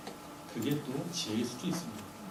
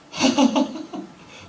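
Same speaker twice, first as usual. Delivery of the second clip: high breathy burst of laughter; 9 dB louder than usual. Retold or imitated. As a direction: break into an unhinged laugh. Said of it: "혼자 웃습니다". (높고 숨찬 웃음)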